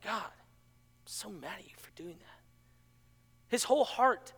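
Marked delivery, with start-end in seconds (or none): 0.0-2.4 whispered grumble to God; a sulky whispered aside. Retold or imitated. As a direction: mutter the complaint under your breath. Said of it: "And he's like".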